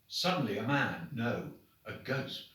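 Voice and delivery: ordinary delivery, nothing marked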